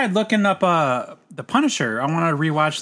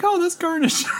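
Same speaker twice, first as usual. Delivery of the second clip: high Pee Wee voice. Retold or imitated. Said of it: "He's like".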